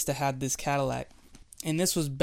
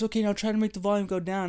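playful dad; 6 semitones higher, faster than usual. Retold or imitated. Dad instructing son, playful.

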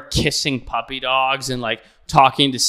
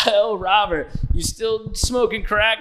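teasing host; 8 semitones higher, 3 dB louder than usual. Retold or imitated. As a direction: rib her buddy playfully. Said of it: "high-fiving with Robert Downey Junior".

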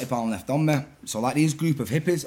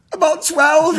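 much higher mock squeak; squeaked confession; 11 semitones higher, 4 dB louder, slower than usual. Owning up to the bag's owner, squeaked confession. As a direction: blurt it high, hammed-up.

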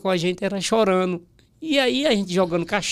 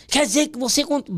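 higher-pitched imitation of an irate boss; Ratinho, angry; 7 semitones higher than usual. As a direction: confront him in a mock-angry voice. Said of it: "brabo, sabe aquele jeitão dele?". (imitando um chefe brabo)

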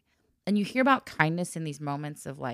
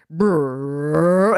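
pipe rumble; 10 dB louder than usual. Retold or imitated. Imitating pipe noise.

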